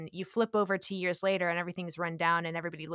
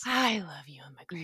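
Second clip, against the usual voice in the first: whispered, like a shared secret; gushing whisper; 3 semitones lower than usual. Real, not acted. Fan whispering her crush on Ewan McGregor, gushing. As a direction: breathe it out as a fond confession. Gushing aside about the actor.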